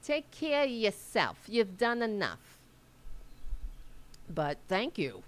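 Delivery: put-on Staten Island accent, motherly and loud. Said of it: "that's kind of a Staten Island accent".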